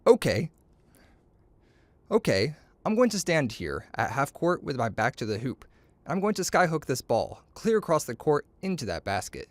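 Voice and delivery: usual speaking voice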